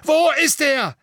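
full, high-pitched furious shout; roar. A narrator's reading. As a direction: bellow it at full force. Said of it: "brüllte Fudge".

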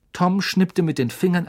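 usual speaking voice

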